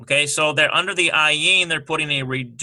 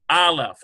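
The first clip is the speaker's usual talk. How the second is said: AH-leph, second syllable dropping; first-syllable stress; 2 semitones higher than usual. Shown as a demonstration. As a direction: hit the first syllable of aleph. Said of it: "accent on this first syllable".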